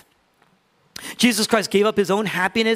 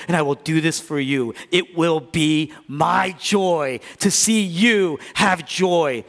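ordinary talk

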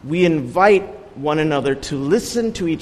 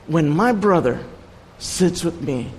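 ordinary delivery

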